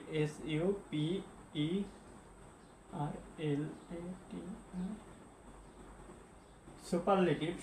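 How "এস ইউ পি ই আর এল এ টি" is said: letter by letter, slow and soft, trailing quieter; slow spelling out. Real, not acted. Teacher writing class notes, slow soft letter-by-letter spelling.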